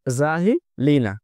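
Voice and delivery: জাহিলিনা said very slowly with a long final না; slow down and let জাহিলিনা ring; careful teacher's slow জাহিলিনা; slow demo pronunciation. Shown as a demonstration. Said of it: "খুব খেয়াল করবেন".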